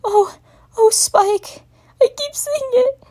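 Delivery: high, loud crying voice; wailing. Acted. Spike wailing while holding Twilight. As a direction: wail it out clinging to her. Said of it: "wailed out the dragon".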